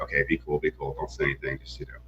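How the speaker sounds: low, soft undertone; quiet aside, holding in excitement; hushed, keeping cool. Retold or imitated. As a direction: say it under your breath, trying to act casual. Acting cool while leaving the sale.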